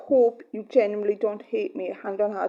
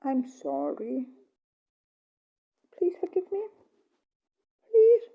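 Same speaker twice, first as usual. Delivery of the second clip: tearful plea; 9 semitones higher than usual. Real, not acted. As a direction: beg forgiveness near tears. Apologising, pleading to partner.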